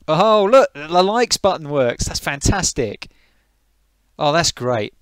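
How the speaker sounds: loud, high delighted outburst; thrilled loud high exclamation; excited. Real, not acted. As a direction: cheer that the button works. Likes button suddenly works.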